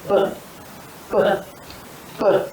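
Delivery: short popped b sounds; letter sound b. Shown as a demonstration. Teacher modelling the b sound for a child. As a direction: pop the lips for each b. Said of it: "what does it say".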